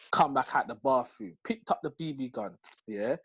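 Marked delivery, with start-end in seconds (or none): none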